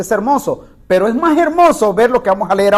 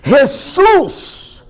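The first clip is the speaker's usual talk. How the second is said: crying out; 6 semitones higher, slower than usual. Retold or imitated. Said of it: "comenzó a dar voces y a decir".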